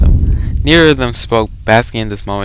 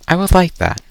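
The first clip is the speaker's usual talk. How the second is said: heroine voiced lightly; 10 semitones higher than usual. Arcane answers, voiced.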